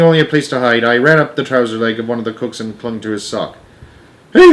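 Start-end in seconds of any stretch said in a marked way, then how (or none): 4.3-4.5 high, loud yelp of surprise; sudden loud outcry; startled shout. A narrator's reading. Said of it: "the cook shouted".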